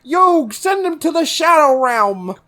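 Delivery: high, mock battle command; hammed-up anime threat; anime duelist. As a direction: call it out like a cartoon card duelist. Acted anime battle line.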